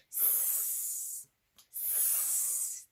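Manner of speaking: long, soft hissing; snake hiss. Shown as a demonstration. Snake coming up to watch, long hiss. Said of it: "There's Snake".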